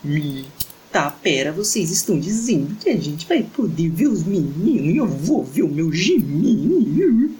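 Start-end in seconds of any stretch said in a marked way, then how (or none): 1.5-7.3 higher, louder stunned fangirl; shocked excitement. A narrator's reading. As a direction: stretch it out in disbelief. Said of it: "tá chocado".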